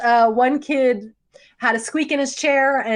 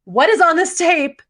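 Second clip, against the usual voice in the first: excited; 6 semitones higher, 4 dB louder than usual. Real, not acted. Dramatic teaser question.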